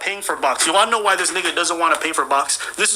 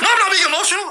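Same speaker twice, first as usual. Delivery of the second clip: mock yell; 12 semitones higher, 4 dB louder, faster than usual. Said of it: "He's like".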